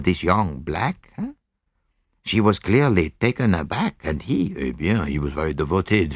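put-on lightly accented detective, thinking aloud; deliberate sleuth, drawing the listener along with little prompts; Poirot. Poirot explains his reasoning to Hastings.

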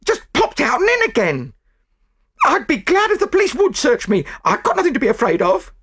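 high, loud, indignant self-defence; angry retort. A narrator's reading. Chambermaid welcomes a police search, indignant. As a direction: protest innocence in a high voice.

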